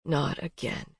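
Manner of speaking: soft low whisper. A narrator's reading. Colton whispering into her hair. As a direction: breathe it softly against her. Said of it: "he whispered".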